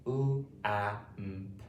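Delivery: U, A, M, P as separate phonics sounds; sounded out. Shown as a demonstration. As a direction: count out the sounds one by one. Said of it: "how many sounds does it have".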